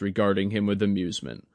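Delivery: usual speaking voice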